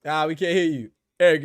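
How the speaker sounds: usual speaking voice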